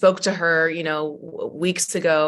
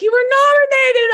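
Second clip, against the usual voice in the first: high, loud excited squeal; 11 dB louder than usual. Retold or imitated. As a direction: squeal the news with joy. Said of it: "she's like".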